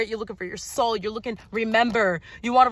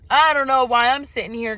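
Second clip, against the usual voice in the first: normal talking voice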